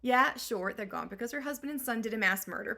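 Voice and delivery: usual speaking voice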